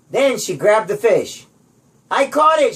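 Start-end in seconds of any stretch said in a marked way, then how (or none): 2.1-2.7 yell. A narrator's reading. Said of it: "she yelled".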